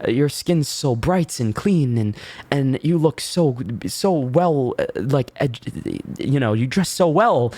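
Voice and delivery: high fawning compliments; gushing beautician. Retold or imitated. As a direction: imitate the salon staff buttering up a client. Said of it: "said same thing, like".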